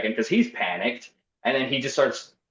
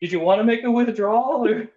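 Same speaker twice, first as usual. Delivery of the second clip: much higher, bright service voice hiding tears; chirpy bank teller fighting back tears; forced teller voice; 9 semitones higher than usual. Retold or imitated. Shaken teller to customer, forced cheery service voice. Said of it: "Just, like, holding back tears".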